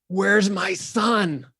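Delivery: slow, high, drawn-out plea; long drawn-out delivery. Retold or imitated. Actress's first slow take, drawn out and high. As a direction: stretch each word like a grieving mother. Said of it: "this was long delivery".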